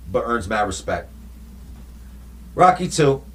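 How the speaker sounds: ordinary talk, nothing marked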